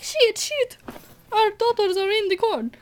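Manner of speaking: high, mocking panic over the daughters; hammed-up parents finding the girls gone; mock parents panicking in the corn maze; mock panicked parents. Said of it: "they're like".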